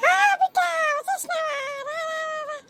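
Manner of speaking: high, loud tearful welcome; put-on wailing. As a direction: wail theatrically to your daughter as she arrives. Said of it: "ఎందుకే mummy ఏడుస్తున్నావు". (నటిస్తూ గట్టిగా ఏడుపు గొంతు)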